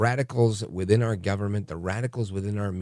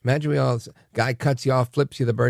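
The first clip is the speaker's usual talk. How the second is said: normal talking voice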